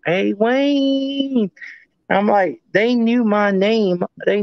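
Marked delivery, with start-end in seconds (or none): 0.1-1.5 imitated female yell. Retelling a female voice heard out there, high mock yell. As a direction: mimic a woman calling out a name in a high voice. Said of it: "I hear a female yell out".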